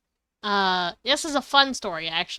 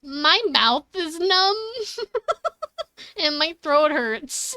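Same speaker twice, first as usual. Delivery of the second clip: laughing; 9 semitones higher, slower than usual. Retold or imitated. Quoting self, laughing.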